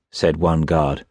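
ordinary delivery, nothing marked

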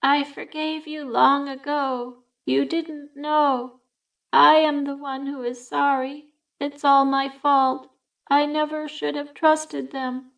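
a forgiving ghost speaking from far away; ghostly voice. A narrator's reading. Bedelia's spirit comforting Myrtle, slow and calm. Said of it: "the voice continued".

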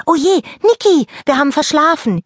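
startled: we overslept; alarmed wake-up. Acted. Rosalie wakes her sleepy friend Niki, alarmed.

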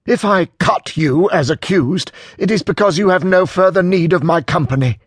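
high, loud retort in a quarrel; indignant retort. A narrator's reading. Acted quarrel between two former friends.

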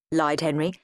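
ordinary delivery, nothing marked

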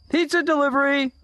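high, fast sing-out call; unfamiliar voice calling. A narrator's reading. Stranger calling to the boys in the tent, sing-out. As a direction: call it out like a delivery person. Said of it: "called an unfamiliar voice".

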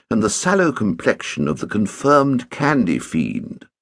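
ordinary delivery, nothing marked

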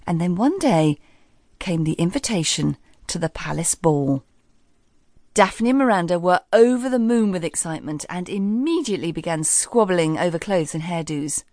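ordinary delivery

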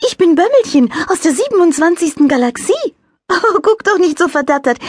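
high, light angel voice; little angel voice. A narrator's reading. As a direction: play a cheerful little angel. Angel character voice.

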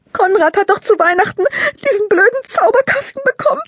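put-on high, loud girl's voice thick with sobs; sobbing. A narrator's reading. Lila explains her brother's magic kit to Maike, sobbing. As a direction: sob through it like a heartbroken child. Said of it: "fährt Lila schluchzend fort".

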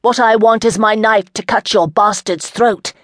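a lady scorning her captor, heated; defiant young lady. Acted. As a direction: throw the threat back at him. Chandra's threat.

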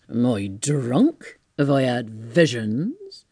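slow, low, bewildered tramp voice; amazed tramp. Acted. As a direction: question your own senses, drawing it out. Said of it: "the dawn of a great amazement".

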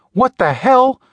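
high-pitched shocked exclamation; startled gasp. A narrator's reading. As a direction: blurt it out, taken aback. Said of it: "the woman gasped".

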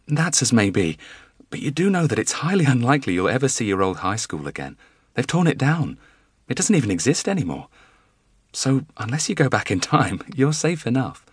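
teasing wife. A narrator's reading. Wife teasing husband about his old school, laughing lightly. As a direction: tease him out of his fears.

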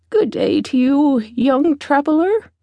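put-on high, lilting old woman's greeting; old beggar woman. A narrator's reading.

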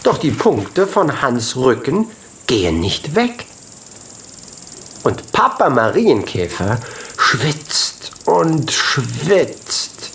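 ordinary delivery

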